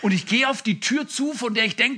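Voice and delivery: ordinary talk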